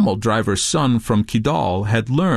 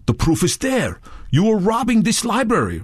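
higher-pitched accusation; accusing; 10 semitones higher than usual. A narrator's reading. Redbeard accusing Touré of robbery, accusing. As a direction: press the charge home. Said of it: "Redbeard said".

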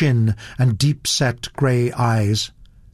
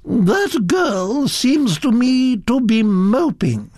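high, slow grande dame voice; grand, drawn-out disapproval; imperious Duchess; 12 semitones higher, slower than usual. Acted. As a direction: pronounce judgment slowly in a high voice. Duchess passes judgment on the girl.

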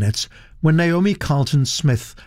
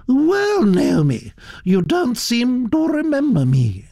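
high, swooping, haughty greeting; high-pitched aristocratic reproach; imperious Duchess; 12 semitones higher than usual. Acted. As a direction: accost a young relative as a grand Duchess.

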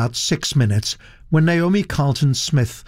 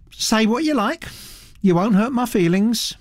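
put-on high, gossipy old aristocrat voice, pitched well up; elderly Duchess; 10 semitones higher than usual. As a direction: chatter on like a grand old lady.

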